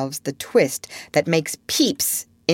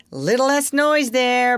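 high, slow call for less noise; 10 semitones higher, 4 dB louder than usual. Acted. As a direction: call across the room to hush them. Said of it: "Peter called out".

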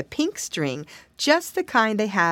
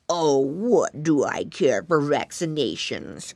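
low, slow bullying creature voice; scornful snail; 5 semitones lower, slower than usual. A narrator's reading. Snail brushing off the piggy boy, low and slow. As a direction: drag it out low and dismissive. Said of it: "cried the snail".

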